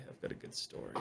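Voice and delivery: breathy teasing whisper. Pretend. Host teasing his story in a whisper. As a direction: hush it like a secret.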